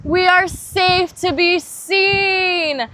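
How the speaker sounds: high, loud and slow, ringing out; a loud, slow group affirmation; group shout. Shown as a demonstration. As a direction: call it out together on three. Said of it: "One, two, three".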